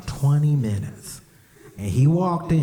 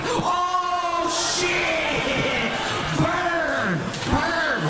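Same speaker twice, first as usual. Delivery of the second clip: very high, drawn-out hype yell; hyping him up; much slower than usual. Retold or imitated. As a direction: crow over a savage burn. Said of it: "I was, like, hyping him up".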